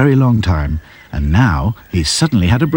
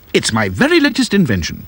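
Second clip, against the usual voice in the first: proud; 8 semitones higher than usual. A narrator's reading. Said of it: "said the professor proudly".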